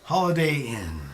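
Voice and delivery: ordinary delivery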